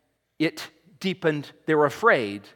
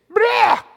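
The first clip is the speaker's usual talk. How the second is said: mock wail; 7 dB louder than usual. Imitating the people crying out.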